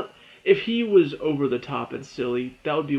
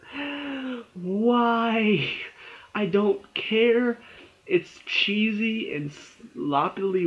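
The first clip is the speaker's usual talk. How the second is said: exasperated groan; 8 semitones higher, much slower than usual. Retold or imitated. Exasperated at cheesy ending.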